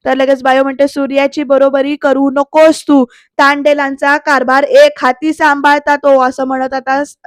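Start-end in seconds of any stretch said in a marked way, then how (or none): 0.9-6.2 loud, emphatic acted reprimand; scolding yell. Bayo warning Sarika not to compare herself, angry scolding. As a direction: put her in her place, voice raised. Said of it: "bio पण ओरडते".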